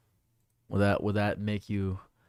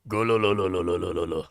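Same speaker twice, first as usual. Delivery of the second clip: rolling cartoon villain laugh; One Piece laugh; 3 semitones lower, faster than usual. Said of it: "That's another one".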